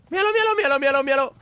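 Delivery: high, rapid excited calling; urgent look-look-look; excited pointing out. Real, not acted. Spotting an enemy.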